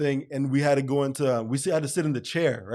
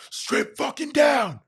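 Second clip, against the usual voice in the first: higher, slow, barked order; mock guard bark; 12 semitones higher, 3 dB louder, slower than usual. Guard ordering the new inmate to strip, slow barked command. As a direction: snap it like a fed-up guard.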